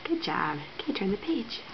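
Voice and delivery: soft whispered praise; whispering. Real, not acted. Praising toddler while reading.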